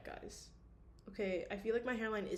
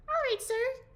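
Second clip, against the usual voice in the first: steward voice; 8 dB louder than usual. Acted. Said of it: "The steward smiled and said".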